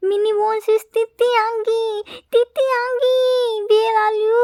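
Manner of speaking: high, loud tearful wail; crying out. A narrator's reading. Frightened child crying for his Titi Angi. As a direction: wail for him through tears.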